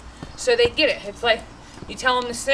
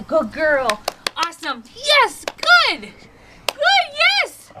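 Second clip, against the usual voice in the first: much higher, louder dog praise; excited praise; 6 semitones higher, 3 dB louder, much slower than usual. Shown as a demonstration. Trainer demonstrating excited praise for her dog. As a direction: show how excited praise sounds. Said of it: "You got a little bit excited".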